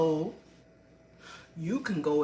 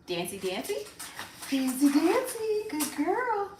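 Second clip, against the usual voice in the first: sing-song dog command; 10 semitones higher than usual. Real, not acted. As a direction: cheer the dog on in a high pet voice. Cueing the dog to dance.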